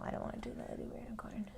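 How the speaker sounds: rushed whispered refusal; fast whisper. Real, not acted. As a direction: hurry it out under your breath.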